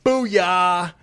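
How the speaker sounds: loud, high, drawn-out hype-man shout; mock hype. Host parodying the DeSantis war room, mock hype shout. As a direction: belt it out like a campaign hype man.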